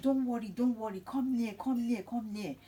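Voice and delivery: high-pitched, eager repeated beckoning; urgent reassurance. Retold or imitated. Joseph calling his scared brothers near, urgent reassurance.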